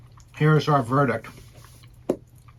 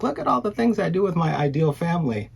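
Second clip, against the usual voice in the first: mock cheery show-off; 6 semitones higher than usual. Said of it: "post to Instagram and be like".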